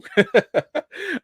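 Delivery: high burst of laughter; amused laugh. Real, not acted. Host thanking the sound check crew, laughing.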